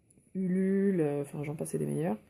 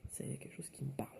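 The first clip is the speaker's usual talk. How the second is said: whispering; 3 semitones lower, 15 dB quieter, faster than usual. Real, not acted. Murmured aside while drawing.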